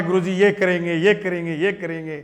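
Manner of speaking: retold speech-making in insistent, repeated beats; imitated student speech. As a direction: reel off pledges like a young campus leader addressing a crowd. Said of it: "तो मैं खड़ा होके".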